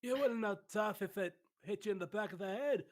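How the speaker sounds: very high, loud Scottish comic voice; high Scottish rant, slowing at the end; Billy Connolly impression. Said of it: "I think it's a Billy Connolly impression".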